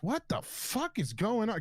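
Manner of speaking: high, alarmed mock shout; dad running downstairs, high alarmed cry. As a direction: burst in panicking. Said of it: "I guess my dad runs downstairs".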